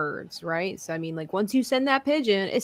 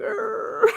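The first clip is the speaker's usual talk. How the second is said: Tusken Raider cry; 14 semitones higher than usual. Retold or imitated. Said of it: "been like".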